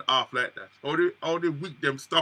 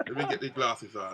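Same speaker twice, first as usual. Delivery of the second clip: ordinary talk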